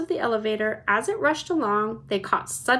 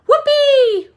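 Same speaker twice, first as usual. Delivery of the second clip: very high, loud joyful whoop; shouting whoopee; 12 dB louder than usual. A narrator's reading. Mike TV spotting television chocolate, shouting with glee. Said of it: "shouted Mike T V".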